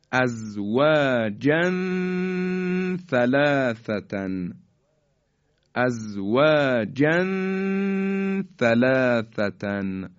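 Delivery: slow ازواجن ثلاثتن with the last n of ازواجن hummed long; measured Quranic recitation of ازواجن ثلاثتن, long vowels drawn out; tanwin ikhfa recitation. Shown as a demonstration. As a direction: hide the tanwin of ازواجن in a held nasal before ثلاثتن. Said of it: "این طرز اخفای".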